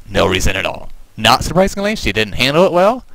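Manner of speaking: ordinary delivery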